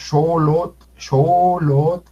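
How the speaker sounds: xolot with both vowels drawn out, "shoo-loot" on O sounds; both Os long. Shown as a demonstration. Teacher giving the word for servant, both Os long. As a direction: hold each O in xolot. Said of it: "con las dos O largas".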